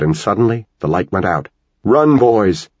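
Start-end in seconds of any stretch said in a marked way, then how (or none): 1.8-2.7 higher, louder urgent shout. A narrator's reading. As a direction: yell at the boys to run. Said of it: "Dan shouted".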